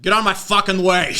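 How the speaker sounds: loud, high New Yorker caricature; pushy mock New York accent. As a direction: shove it out in hammed-up New Yorkese. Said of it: "America has three accents".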